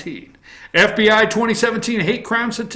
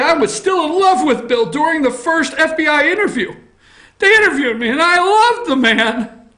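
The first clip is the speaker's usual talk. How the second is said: higher, lovestruck woman's voice; mock Monica voice; 8 semitones higher, 3 dB louder than usual. Quoting Lewinsky on Bill, higher lovestruck voice. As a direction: gush it like a smitten young woman.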